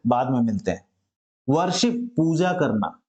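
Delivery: ordinary talk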